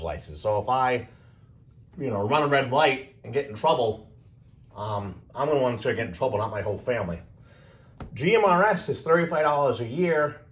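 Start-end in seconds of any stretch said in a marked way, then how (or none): none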